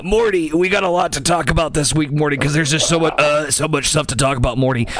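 slurred, drawling Rick voice; Rick impression. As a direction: do a lazy Rick Sanchez. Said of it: "I can't do the fucking impressions".

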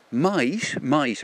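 "might" stretched out with a big pitch swing; slow, emphatic "might", said twice for caution; stress on might. Real, not acted. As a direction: lean hard on the word "might". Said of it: "I stress the word might".

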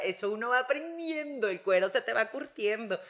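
usual speaking voice

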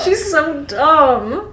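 high, drawn-out mock wail; slow, laughing exasperation; exasperated. Real, not acted. Reader despairing at Bella's stupidity, exasperated.

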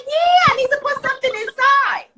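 put-on little boy voice. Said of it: "He's like".

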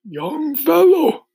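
loud, drawn-out scorn; slow scornful voice. A narrator's reading. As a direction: address him slowly with withering scorn. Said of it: "speaking in a deep, slow, scornful voice".

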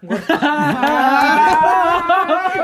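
loud, high burst of laughter; shrieking laughter. Real, not acted. Friend bursting out at a video call, shrieking laughter.

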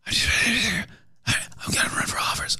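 slow, hushed imitation; mock secretive whisper. Host mocking candidates who announce privately, secretive whisper. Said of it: "they whisper it".

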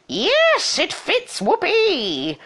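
very high gleeful squeal; high-pitched, whooping celebration; screamed delight. A narrator's reading. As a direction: shriek it in triumph. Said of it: "But she screamed".

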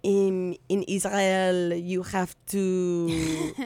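put-on Israeli accent, French-like vowels; a playful Israeli accent, slow. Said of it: "give me something to say".

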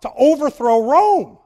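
rising preacher's cry; preaching emphasis. Real, not acted. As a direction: hammer it out to the crowd. Preacher punching his point.

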